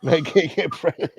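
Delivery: laughing through the words; chuckling as he repeats it. Real, not acted.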